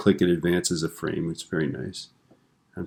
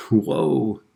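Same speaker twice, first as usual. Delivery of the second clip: higher-pitched startled whoa; mock freak-out; 4 semitones higher than usual. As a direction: act spooked by a talking bull. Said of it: "he just freaks out".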